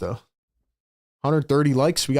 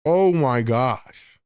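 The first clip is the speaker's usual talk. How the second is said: subdued disbelief; 2 semitones lower, much slower than usual. Real, not acted. Streamer still stunned by a near dunk, subdued disbelief. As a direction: let the amazement sink in, drawn out. Same reaction, settling after it.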